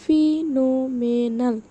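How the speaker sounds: ফিনোমেনাল slowly broken into syllables. Shown as a demonstration. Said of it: "সিলেবলগুলো ভেঙে ভেঙে". (ফিনোমেনাল ধীরে ভেঙে ভেঙে)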